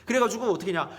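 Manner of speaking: ordinary delivery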